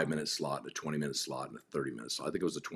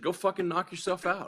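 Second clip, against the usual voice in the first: mock dare; 14 semitones higher than usual. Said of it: "I said".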